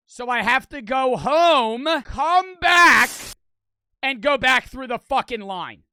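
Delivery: fed-up outburst at having to go home; pissed off. Retold or imitated. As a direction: yell it out in frustration. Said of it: "I'm pissed".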